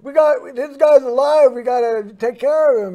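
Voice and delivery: acted yell, high at first, then easing; nurse yelling. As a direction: call out urgently to the other nurses. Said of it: "she yells for the other nurses".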